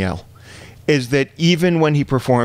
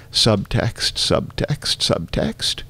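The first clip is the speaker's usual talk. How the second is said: quieter each time, ending whispered; fading whisper; 4 dB quieter, much slower than usual. Retold or imitated. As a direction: let it fade away breathily.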